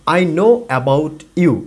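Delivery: "I know about you" said very slowly, word by word; slow careful reading. Shown as a demonstration. Teacher reads the phrase slowly before the native version. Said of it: "এটাকে যখন একজন native বলে".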